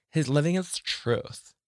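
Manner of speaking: put-on slow, high valley girl lilt; lightly voiced valley girl. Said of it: "I'm doing that as a, a Valley girl".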